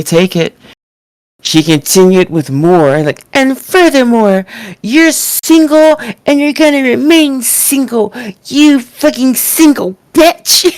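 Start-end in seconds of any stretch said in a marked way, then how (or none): 3.3-10.7 higher-pitched mock tantrum, slowing on the final jab; mocking Tiffany's screaming. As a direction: squeal the insult like a kid in a tantrum. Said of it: "started screaming like a twelve-year-old".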